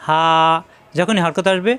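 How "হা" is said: হা with a long open vowel; drawn-out letter name. Shown as a demonstration. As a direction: stretch হা from an open throat. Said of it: "এখানে কিন্তু টান দেওয়া যাচ্ছে".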